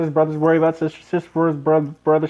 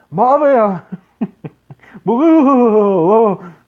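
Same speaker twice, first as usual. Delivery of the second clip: a hint of Bobby Hill, louder and higher; Bobby Hill impression; 5 semitones higher, 9 dB louder, much slower than usual. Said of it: "Bobby Hill".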